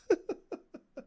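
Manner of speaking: sudden high-pitched burst of laughter; high squeaky laugh. Real, not acted. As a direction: let out a squeaky giggle. Laughs at own story.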